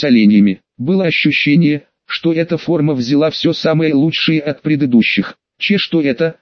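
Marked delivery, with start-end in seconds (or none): none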